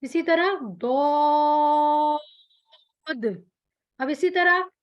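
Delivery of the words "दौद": slow दौद with bouncing d; daad with qalqala. Shown as a demonstration. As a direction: stretch दौद, bounce the end. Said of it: "कलकला दाल पे किया".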